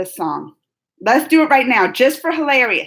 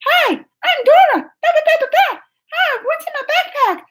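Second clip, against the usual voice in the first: Dora voice; 4 dB louder than usual. Retold or imitated. Vlogger mimics Dora greeting viewers, very high cartoon voice. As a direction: squeak it like the corny cartoon Dora. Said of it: "she was like".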